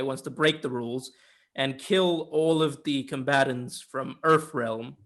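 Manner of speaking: ordinary talk, nothing marked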